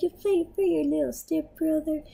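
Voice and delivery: high-pitched mock voice of a friend; imitating friend. Vlogger quoting a friend's banter, imitating him. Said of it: "He's like".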